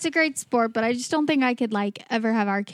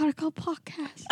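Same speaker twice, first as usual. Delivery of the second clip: quiet higher goofy voice; soft silly voice; 4 semitones higher, 8 dB quieter than usual. Retold or imitated. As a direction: murmur it in a cartoon voice. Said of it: "that the voice you chose".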